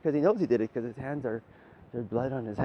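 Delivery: ordinary talk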